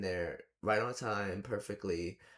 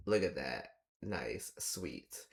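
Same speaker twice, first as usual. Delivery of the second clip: mock deadpan approval; 6 semitones lower, 4 dB quieter, much slower than usual. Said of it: "it's like".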